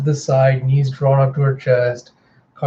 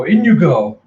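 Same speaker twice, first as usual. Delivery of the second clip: mock send-off; 3 semitones higher, 6 dB louder than usual. Captors toss prisoner in.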